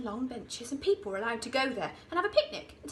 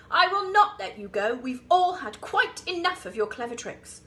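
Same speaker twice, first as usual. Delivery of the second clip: firm refusal; 9 dB louder than usual. A narrator's reading. Trunky firmly refusing crocodile.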